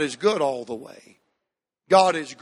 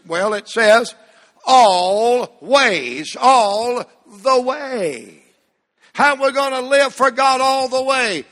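high, slow, stretched pulpit proclamation; fervent revival sermon cadence; impassioned preaching; 5 semitones higher, 6 dB louder, slower than usual. Real, not acted. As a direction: hammer every "all" for the congregation. Preacher proclaims verse and refrain.

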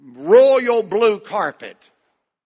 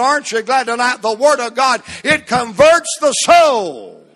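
higher-pitched, driving revival preaching; fervent preaching; 5 semitones higher, 4 dB louder than usual. Real, not acted. Preacher urging sinners to read the Word, fervent preaching. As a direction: preach it hard, building to the last line.

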